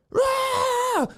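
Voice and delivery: high drawn-out "rah"; mock battle roar. Man charging fully flared up, mock roar. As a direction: roar like someone charging across a field.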